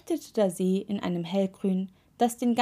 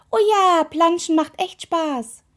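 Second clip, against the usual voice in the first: high, loud exclamation, slowing at the end; calling out; 9 semitones higher, 8 dB louder than usual. A narrator's reading. Toe calling out to the witch about splashing, loud cry. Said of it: "Der Rausguckzeh rief".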